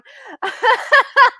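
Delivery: short, loud high cackle; burst of laughter. Real, not acted. Host laughs while reading comments.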